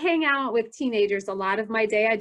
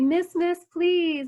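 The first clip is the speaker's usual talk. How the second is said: higher, imploring call; coaxing, raised-pitch plea; pleading sales call; 6 semitones higher, slower than usual. Retold or imitated. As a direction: imitate a seller begging for attention. Kiosk seller calling to passer-by.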